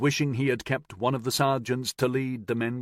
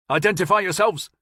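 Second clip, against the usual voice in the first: higher, louder nervous challenge; nervous shout; 8 semitones higher, 6 dB louder than usual. A narrator's reading. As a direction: shout it into the dark, unsure. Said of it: "one nervous soldier shouted".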